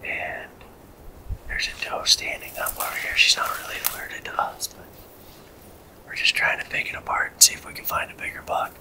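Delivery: hushed hunting whisper. Real, not acted. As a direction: whisper so the deer won't hear.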